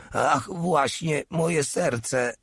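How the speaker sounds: high slow mock groan. A narrator's reading. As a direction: moan it out, faking pain. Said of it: "jęknął". (udawany jęk, wysoko i wolno)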